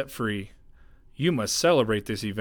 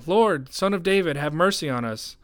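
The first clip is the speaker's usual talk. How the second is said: raised, pleading cry for mercy; shouting; 6 semitones higher, 4 dB louder than usual. A narrator's reading. Two blind men call to Jesus as he passes, shouting. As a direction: call out high, easing toward the end. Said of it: "they began shouting".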